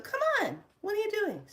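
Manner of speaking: very high playful question; high coaxing. Retold or imitated. Asking the cat what it's doing, very high.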